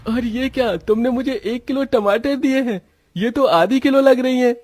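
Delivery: very high-pitched voice of an indignant customer; complaining tomato customer, indignant. Acted. Customer protesting to the seller about underweight tomatoes, indignant.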